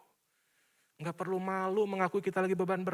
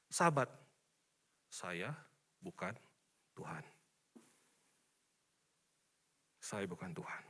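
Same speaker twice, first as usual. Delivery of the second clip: hushed and deliberate; 13 semitones lower, 13 dB quieter than usual. Acted. Slow hushed acronym reading.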